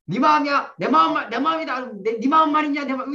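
put-on childish squabble. Teacher recalls childhood fights, squabbling like a kid. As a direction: taunt like a little child in a spat. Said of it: "이렇게 하면 싸웠어요".

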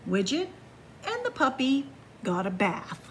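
ordinary delivery

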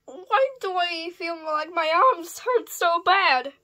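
ordinary delivery

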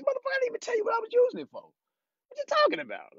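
a high, mock-outraged comeback, fast then slowing on the question; exasperated retort. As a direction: snap back high like you can't believe the nerve. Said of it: "I'm like".

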